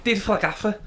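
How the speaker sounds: high-pitched Scouse pundit impression; hammed-up Scouse footballer turned pundit; Jamie. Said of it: "No, I'm just doing Jamie".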